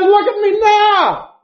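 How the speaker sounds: loud, high cry from the prison house; Samson's anguished cry. Retold or imitated. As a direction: shout it out, broken and mocked. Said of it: "and he says".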